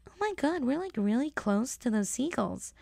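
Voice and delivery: high breathy valley-girl gush, imitated; friend's valley voice. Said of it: "my friend who talks like this".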